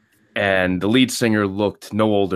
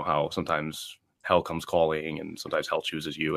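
usual speaking voice

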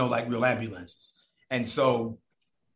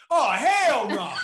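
high, loud, slow and laughing; drawn-out girlfriend refusal; 11 semitones higher, 7 dB louder, much slower than usual. Retold or imitated. Girlfriend hears it's The Real World, scandalised high drawn-out no. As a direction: stretch out a scandalised no.

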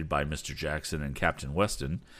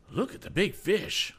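much higher, sudden excited call; sudden cry; 12 semitones higher than usual. Acted. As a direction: point it out in a rush. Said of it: "cried Mister Damon suddenly".

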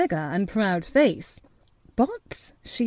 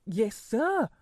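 gardener voice; 4 dB quieter than usual. A narrator's reading. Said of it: "touched his hat gardener fashion".

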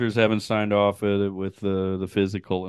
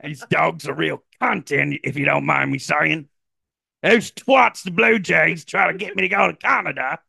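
high comic Australian rant; mock-indignant Australian storytelling; Australian accent; 9 semitones higher than usual. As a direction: ham up a broad Australian accent. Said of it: "got an Australian accent".

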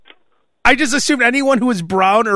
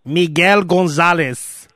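put-on Spanish accent on name; slower than usual. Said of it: "that's exactly what you're talking about".

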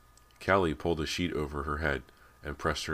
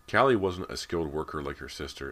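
ordinary delivery

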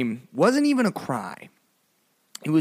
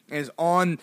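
ordinary delivery

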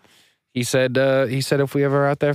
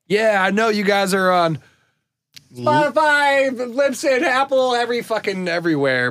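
mock listener voice; 9 semitones higher than usual. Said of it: "some people have emailed us and been like".

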